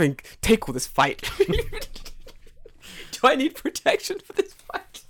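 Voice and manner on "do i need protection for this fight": slow, high, through laughter; laughing. Real, not acted. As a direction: barely get it out laughing.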